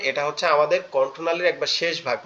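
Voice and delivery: ordinary delivery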